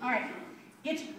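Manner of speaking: ordinary delivery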